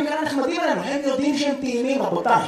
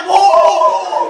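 higher, louder drawn-out call; mock cow cry; 8 semitones higher, 11 dB louder, much slower than usual. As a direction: stretch the last word out loud. Imitating a cow before slaughter. (קריאה מוגזמת של פרה)

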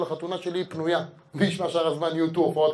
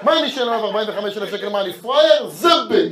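mock indignant rant; 4 semitones higher, 8 dB louder than usual. Refusing to pay.

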